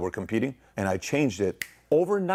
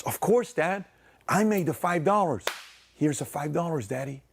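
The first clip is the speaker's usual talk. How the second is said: put-on much higher, sweet innocent kid voice; eager kid; 7 semitones higher than usual. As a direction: sell the lie like an eager child. Son lying sweetly to dad.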